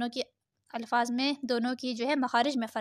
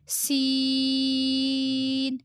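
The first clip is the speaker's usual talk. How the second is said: one long level held vowel; drawn-out letter; much slower than usual. Shown as a demonstration. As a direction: stretch the letter name slowly. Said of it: "پانچ انگلیوں کے برابر کھینچیں گے".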